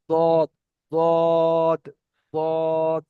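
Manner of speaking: drawn-out "দদ" with a heavy D; tongue's side against upper molar roots for each "দদ"; tongue-side Dad. Shown as a demonstration. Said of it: "মাড়ির দাঁতের গোড়ার সঙ্গে লাগিয়ে". (জিভের কিনারা মাড়ির দাঁতে লাগিয়ে "দদ")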